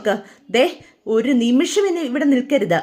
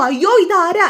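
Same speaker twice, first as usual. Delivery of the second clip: a sudden, higher, louder yelp of shock; startled cry; 5 semitones higher, 6 dB louder than usual. Acted. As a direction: jump back at the face in the mirror. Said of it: "Balan ഞെട്ടിപ്പോ".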